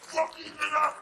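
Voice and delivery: a loud, high fighting cry; battle yell. Retold or imitated. Comedian landing the kick on Mitch, loud yell. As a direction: shout it high as you kick. His own kick acted out.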